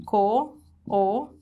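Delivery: co-o-pe-rar chopped slowly syllable by syllable; split into syllables. Shown as a demonstration. Teacher splitting cooperar into syllables. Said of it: "Como que eu separaria a sílaba disso?".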